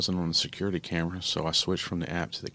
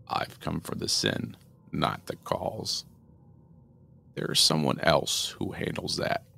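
menacing demon; 2 semitones lower, slower than usual. Acted. Shadow demon telling the mother why he came, slow menacing rasp. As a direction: draw each word out like a shadow.

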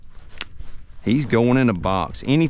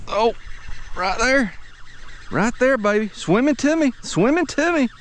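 excited; 11 semitones higher, 4 dB quieter than usual. Real, not acted. Fish swimming to the boat.

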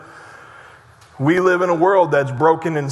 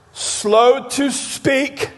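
slow, drawn-out; 4 semitones higher, much slower than usual. Acted. Drawn-out scripture phrase.

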